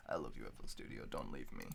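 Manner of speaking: low, soft, clinging plea; mock tender plea. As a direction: murmur it like a lover begging. Mock breakup with software.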